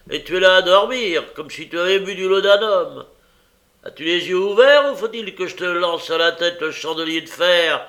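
high, loud, sustained telling-off; exasperated shouting tirade; angry scolding. A narrator's reading. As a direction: berate a dozing servant from afar. Scolding a sleeping servant loudly.

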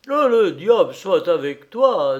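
agitated storybook oath; cursing. A narrator's reading. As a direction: curse it and let go. Frightened grandfather curses the pot.

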